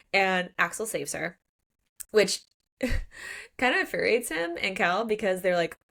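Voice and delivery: ordinary talk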